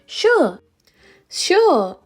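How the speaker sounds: high, loud, glad yes; glad agreement. A narrator's reading. Eunhae lets Joey keep the paper, glad. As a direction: agree with a big pitch swoop.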